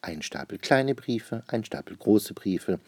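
ordinary delivery, nothing marked

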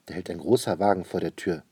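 ordinary delivery, nothing marked

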